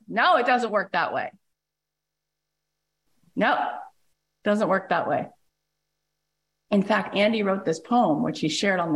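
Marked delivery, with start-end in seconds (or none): none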